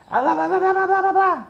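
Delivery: high-pitched mock rant in blah-blahs; mock yelling. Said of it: "she starts yelling at her".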